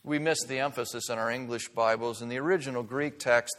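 ordinary talk

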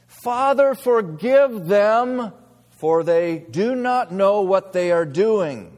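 loud, high, slow cry of Father; Jesus crying out from the cross, slow and loud; pleading prayer. Acted. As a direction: preach it as an anguished plea, drawn out.

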